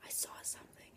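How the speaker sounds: barely audible whisper; scared whisper. Retold or imitated. Breadstick returning from the other room, scared whisper. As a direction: whisper it as a frightened report.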